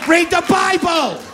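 high, shouted rant; shouted command. Acted. Comedian scolds the crowd to read the Bible, shouting. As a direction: yell it like a furious preacher.

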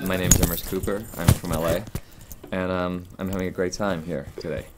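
British actor doing an American accent. Shown as a demonstration. Guest giving a sample for viewers, American accent. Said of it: "sample of your American accent".

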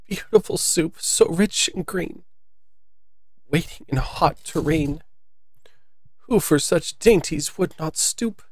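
high, soft song choked with sobs; the Mock Turtle sobbing through his soup song. A narrator's reading. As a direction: sing it tearfully, voice catching. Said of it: "a voice sometimes choked with sobs".

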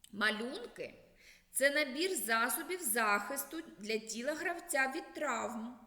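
ordinary talk, nothing marked

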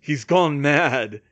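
loud, slow statement; cutting through. A narrator's reading. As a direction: raise the voice to cut through. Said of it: "Harry's voice cut through".

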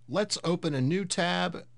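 normal talking voice

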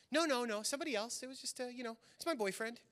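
lightly voiced wife, airy and quick; man playing a woman. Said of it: "himself playing a woman".

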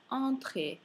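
"entrée" opening on a nasal "an", drawn out slowly; nasal vowel. Shown as a demonstration. Teacher demonstrates French nasals, slow nasal first vowel. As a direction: open "entrée" through the nose, no full N.

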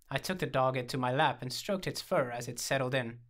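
ordinary delivery, nothing marked

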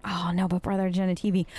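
ordinary talk

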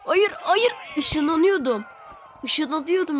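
high, loud dismay; panicked protest. Real, not acted. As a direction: cry out at the mistake.

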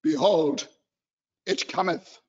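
low, stiff, pompous churchy voice; mock-solemn King James drone; solemn adult. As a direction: intone it like a stuffy old pew-sitter. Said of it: "We're gonna sit here and go".

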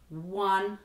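usual speaking voice

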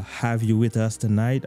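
normal talking voice

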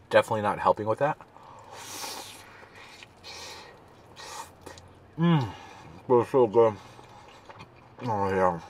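chewing while talking; muffled through food; mouth full. Real, not acted. Seen 3: Cheese string from lips, mouth closed and pursed, chewing the burrito bite.

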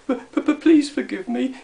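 high little pleading stutter; tearful stammer. A narrator's reading. Gnome begging Dame Tab's forgiveness, stammering tearfully. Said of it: "he stammered in between sobs".

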